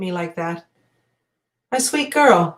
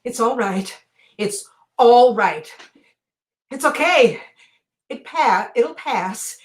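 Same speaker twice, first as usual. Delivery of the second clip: soft, halting, comforting murmur; calm them quietly, with pauses between phrases; soothing reassurance; 2 semitones higher, 5 dB quieter than usual. Acted. Telling someone it's all right and will pass, soft reassurance.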